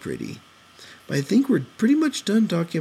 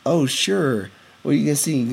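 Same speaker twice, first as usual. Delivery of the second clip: ordinary talk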